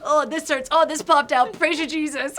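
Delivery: hammed-up groaning, laughing, high; mock suffering. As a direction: play up the pain while laughing. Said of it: "and I'm like".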